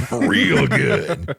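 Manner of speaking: laughing echo. Real, not acted. Echoing a joke while laughing.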